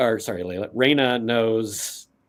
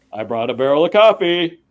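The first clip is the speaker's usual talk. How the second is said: higher, louder, a touch of showmanship; proud announcement; 5 semitones higher, 7 dB louder than usual. Acted. Clancy shows Kim the barrel, proudly announcing. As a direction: present your gift proudly.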